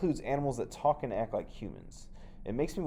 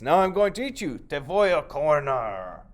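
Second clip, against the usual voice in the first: higher, louder slow threat; menacing snake; 6 semitones higher, 9 dB louder than usual. Acted. The snake threatening to eat the farmer, slow and loud. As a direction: savour the threat.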